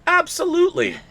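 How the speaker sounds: put-on squeaky high kid reply; high eager voice. Mock kid answering eagerly.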